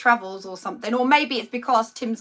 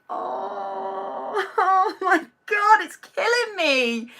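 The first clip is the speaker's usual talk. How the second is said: high, hammed-up wail of despair; mock sobbing; 7 semitones higher, much slower than usual. Telling viewers about a celebrity couple's split, mock sobbing. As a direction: play at crying over the celebrity breakup. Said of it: "I'm devastated".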